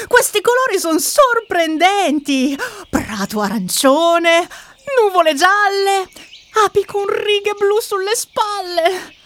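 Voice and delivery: high-pitched wonder at each new sight; excited sister. Acted. Sister shows scared brother the surprising colours, excited. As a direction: marvel aloud like a thrilled child.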